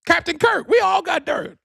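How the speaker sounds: high, loud shout. Real, not acted. Host shouting to listeners that everyone has dirt, high and loud. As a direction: yell it out like a rallying cry.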